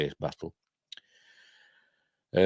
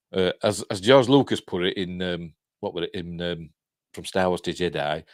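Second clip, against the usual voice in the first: normal talking voice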